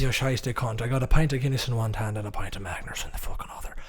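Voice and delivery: put-on playful Irish pub accent. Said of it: "you guys are good at Irish".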